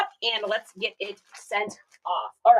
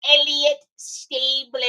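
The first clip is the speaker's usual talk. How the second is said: put-on call of a name; 3 semitones higher, much slower than usual. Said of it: "this little thing".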